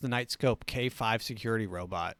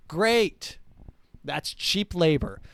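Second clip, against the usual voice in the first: sarcastic; 6 semitones higher, 5 dB louder, slower than usual. Real, not acted. Sarcastic cheer at cheap labor.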